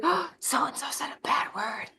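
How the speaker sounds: whispered secret; a hushed tattle. Retold or imitated. Kid whispering to the teacher about a bad word. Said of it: "one of the kids will be like".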